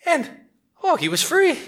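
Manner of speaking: high, bright burst of joy; delighted narration; joyful release. A narrator's reading. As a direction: soar with relief. Monkey liberated, story climax.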